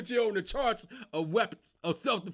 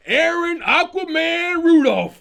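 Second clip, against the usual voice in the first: hype hero intro; 8 semitones higher, 13 dB louder, slower than usual. Pretend. Hero entrance, hype call.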